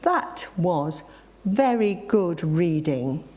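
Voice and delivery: slow, weighted "That was very good reading"; "That was very good reading", spaced out and emphatic; emphasizing each word. A narrator's reading. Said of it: "emphasizing each word with an emphatic nod".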